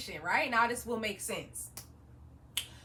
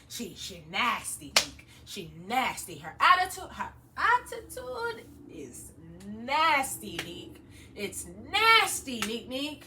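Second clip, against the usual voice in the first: lower, slow Caribbean mom repeating nasty; mildly Jamaican, drag out nasty; imitating Caribbean mom; 4 semitones lower, slower than usual. Jamaican mom complaining to her son Nick, low and slow. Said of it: "Caribbeans repeat stuff eighty times".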